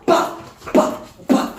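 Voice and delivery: whispered slap noises; slap sound effects. Retold or imitated. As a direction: mime the slaps with your voice. Bruitage des coups. (bruitage de gifles chuchoté)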